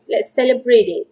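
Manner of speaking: normal talking voice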